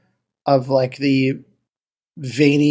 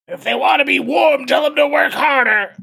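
angry boss; 11 semitones higher than usual. Retold or imitated. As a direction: bark orders like a cruel factory lord. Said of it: "just be like".

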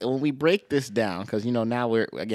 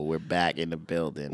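ordinary talk

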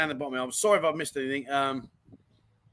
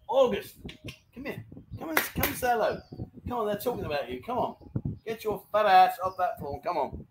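coaxing, higher-pitched call to a pet; calling the dog; 4 semitones higher than usual. Real, not acted. Owner calling August to come and say hello, coaxing. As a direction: urge a lazy dog to get up.

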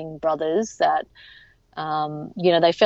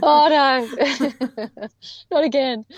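high, louder "oh no", laughing into "not again"; laughing mock dismay; 7 semitones higher, 4 dB louder, much slower than usual. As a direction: groan it through laughter.

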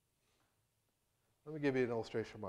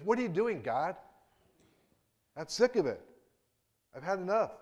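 loud, high exasperated complaint; sick of it all, let it burst out; fed-up outburst; 8 semitones higher, 6 dB louder than usual. Retold or imitated. Elijah complaining to God, loud and fed up. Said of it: "The implication is".

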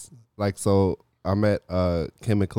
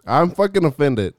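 mock offended; 7 semitones higher, 6 dB louder than usual. Mock outrage.

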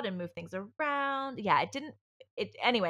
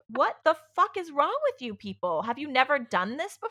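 exasperated outburst; 8 semitones higher, 6 dB louder than usual. Retold or imitated. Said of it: "and I am just like".

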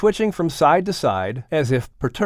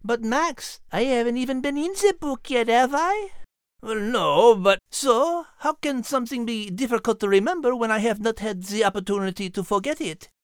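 a higher, light character voice, gently puzzled; lightly voiced storybook character; 8 semitones higher than usual. Book character quizzing Max, in a light raised voice. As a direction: play the curious book character, mildly.

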